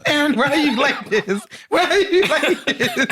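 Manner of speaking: helpless laughing exasperation. Real, not acted. Woman scolding her co-host over his crude joke, laughing.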